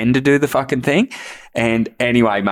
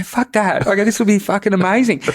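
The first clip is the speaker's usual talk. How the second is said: higher, eager push for the prank; excited egging on; 9 semitones higher than usual. Retold or imitated. Talking Banya into the fake dead battery, excited and higher. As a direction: pitch it up, dead keen on the joke.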